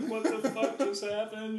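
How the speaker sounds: high, slow, groggy mock bewilderment; just woken and baffled; half-awake confusion. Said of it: "half awake".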